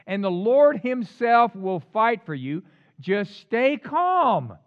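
loud, slow proclamation; rousing, stretched-out assurance to a crowd; Moses addressing people. Acted. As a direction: declare it with force, drawing out the last words. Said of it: "Moses told the people".

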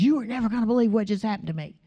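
rushed, breathless-paced retelling of a shock; freaked out. Retold or imitated. Housekeeper bursting in to tell the pastor, freaked out. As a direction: blurt it out fast, imitating someone freaked out. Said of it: "she was completely freaked out".

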